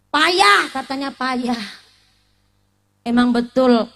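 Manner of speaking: ordinary delivery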